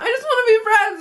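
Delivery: high-pitched whiny sob imitation; mock crying. Retelling her tearful plea to a friend, mock crying. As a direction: plead in a high, comic crying voice. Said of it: "I started crying".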